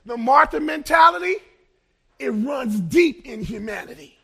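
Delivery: loud, rising preacher's call to the congregation; full-force preaching that climbs on each example. Acted. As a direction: hammer each example home. Preaching resumes.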